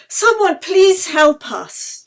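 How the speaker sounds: loud, high, slow cry for help; shout for help. A narrator's reading. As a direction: call out desperately to passers-by. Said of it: "Yeli shouted".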